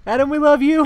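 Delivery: high, loud shout-out; calling out affectionately. Real, not acted. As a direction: send it up high like a cheer across the room. Shouting out to absent Adam.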